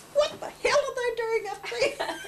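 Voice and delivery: high, loud, laughing outburst; laughing disbelief. Retold or imitated. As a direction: shout it half-laughing, astonished. Retold startled outburst, laughing.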